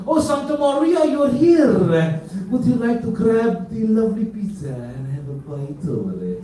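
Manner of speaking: loud hammed-up Italian host accent; mock Italian accent. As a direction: ham up an Italian waiter greeting you. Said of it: "Italiano people have the lovely attitude".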